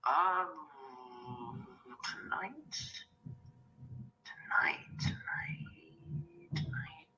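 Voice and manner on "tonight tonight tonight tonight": frantic whispered stalling; panicked breathless whispers. Acted. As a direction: whisper it in a panic, buying time. Stalling, caught off guard by invite.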